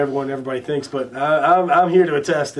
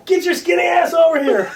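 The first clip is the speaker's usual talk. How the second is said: much higher, loud hammed-up holler; imitated yell; 14 semitones higher, 5 dB louder than usual. Ballplayer ordering the young singer over, imitated loud yell. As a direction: bark the order across a crowded room. [name] summons [name] across the room.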